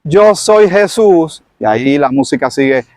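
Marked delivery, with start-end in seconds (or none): 0.0-1.4 slow, raised, solemn declaration; Jesus reveals himself to Saulo, slow and solemn. Acted. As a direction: announce it slowly from on high. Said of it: "Y le dijo".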